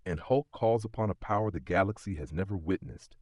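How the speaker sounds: plain reading voice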